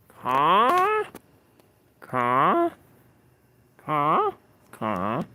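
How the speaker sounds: caws bending upward, easing off at the end; bird caw. Shown as a demonstration. Streamer probing the thing in the tree, questioning bird caws. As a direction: call like a crow waiting for an answer.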